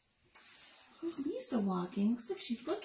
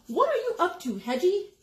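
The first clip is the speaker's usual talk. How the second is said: mama pig; 6 semitones higher, 5 dB louder than usual. Acted. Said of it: "the mama pig asked".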